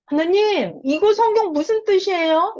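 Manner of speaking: high, earnest asking voice; pleading question to God. Retold or imitated. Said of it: "이렇게 물어봐야 돼요".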